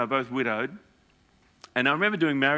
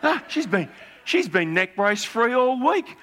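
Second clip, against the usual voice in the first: much higher, laughing "No, no, no"; overjoyed husband laughing the news; 9 semitones higher, 3 dB louder, slower than usual. Retold or imitated. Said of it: "He goes".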